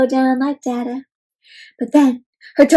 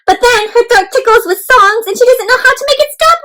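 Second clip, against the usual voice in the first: excited narration; 12 semitones higher, 4 dB louder than usual. A narrator's reading. Excited storytelling.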